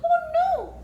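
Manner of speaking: very high, loud "oh no"; mock horror. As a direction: squeal it in exaggerated dismay. Storyteller sees muddy carpet.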